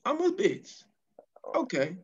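high, slow put-on indignation; a high, slow, mock-offended challenge. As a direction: drag it out like a hammed-up dare.

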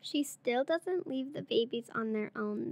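usual speaking voice

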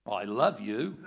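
flat, blunt "I love you" with a rising end; unromantic love line. Shown as a demonstration. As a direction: toss it off with no tenderness. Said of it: "it's gotta be the tone of voice".

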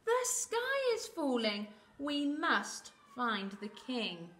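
high alarm squawk, settling into slow urging; squawking birds. Acted. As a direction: cry out like flustered birds, then stretch the plea. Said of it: "squawking and clucking and quacking".

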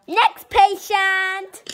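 high, slow loud call. Pretend. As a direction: call the next patient in. Calling out to waiting room.

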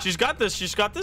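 root for a teammate with rising excitement; excited rooting. Real, not acted. Player watching a teammate flee enemies, excited rooting.

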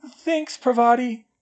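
whispered then whined, high; a weak, tearful thank-you; a crying girl thanking her friend; half-hearted whine. A narrator's reading. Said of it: "she whined half-heartedly".

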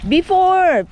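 loud, high calling out; yelling across the room. Real, not acted. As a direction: call it across to them. Telling the kids across the beach.

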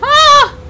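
high, loud startled scream; panicked scream. Real, not acted. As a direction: scream as the slime comes. Fleeing from a fire slime.

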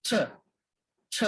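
ছ hissed out against the teeth, no voice; unvoiced ছ demo. Shown as a demonstration. Teacher showing students how ছ hits the teeth. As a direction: push ছ air past the teeth. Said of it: "দাঁতের সাথে বাড়ি খেয়ে একটা শব্দ হচ্ছে".

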